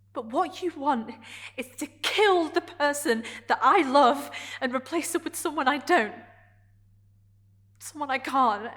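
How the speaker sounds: hurt pouring out in stressed bursts; anguished accusation. Acted. Character accusing Adrian of replacing the one she loves, anguished.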